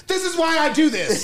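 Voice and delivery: high-pitched retold protest; exasperated. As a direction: make your point, worked up. Acts out arguing with his mother.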